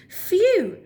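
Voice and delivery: high, breathless relief; relieved beaver. Acted. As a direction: sigh of relief after a narrow escape. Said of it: "thought Barry".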